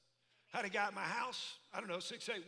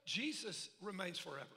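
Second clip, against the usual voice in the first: normal talking voice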